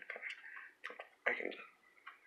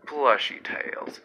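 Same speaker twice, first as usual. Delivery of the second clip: ordinary talk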